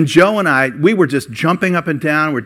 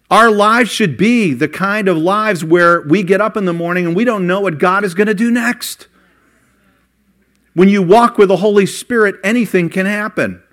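higher and louder, driving the point home; preaching at full force; 5 semitones higher, 4 dB louder than usual. Acted. Preacher urging the congregation about the Holy Spirit, fervent and loud. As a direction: lift the congregation with fervour.

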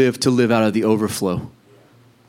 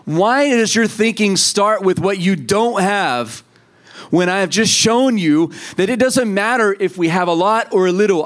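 voice of Jesus; 4 semitones higher than usual. Acted. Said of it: "he said".

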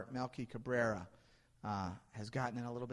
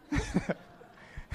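real laugh; 14 semitones higher than usual. Real, not acted. Laughing at own story.

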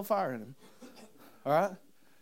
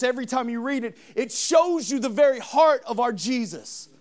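louder, higher fervent sermon; preaching at full force; 5 semitones higher, 7 dB louder than usual. Acted. Preacher rouses the congregation about John twenty-one. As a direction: lift the church up with it.

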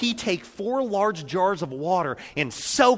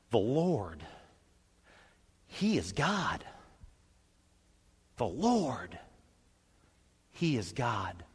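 hushed, softer worship; bow low and confess it softly; reverent; 6 semitones lower, 10 dB quieter, slower than usual. Retold or imitated. People cry out, prostrate.

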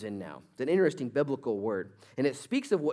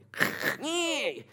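short laugh; 14 semitones higher than usual. Real, not acted. Laughs after quoting people.

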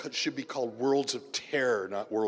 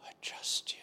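barely voiced murmured prayer; whispered; 7 dB quieter than usual. Shown as a demonstration. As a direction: whisper "I trust you" like a worn-out plea. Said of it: "get out a murmured".

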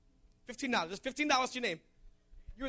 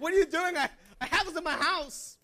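mock indignation; 6 semitones higher, 6 dB louder than usual. Acts out indignant reaction to Janet.

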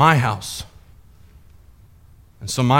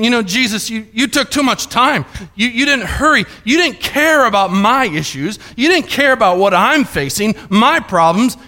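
accusing father; 7 semitones higher than usual. Retold or imitated. Jairus's thoughts turn to anger.

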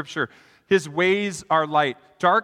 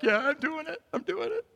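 put-on kid's proud voice; 10 semitones higher, 6 dB quieter than usual. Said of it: "you're like".

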